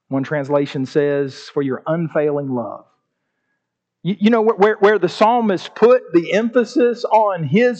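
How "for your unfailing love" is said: low, soft, pleading psalm reading; lower, softer penitent reading, slowing at the end; humble scripture reading. Acted. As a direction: read it like a broken man praying. Alternate translation read.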